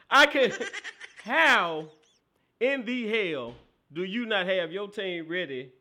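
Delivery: loud, drawn-out, incredulous outburst; slow it down and demand an answer, loudly; angry rant. Real, not acted. Pissed rant at the losing coach.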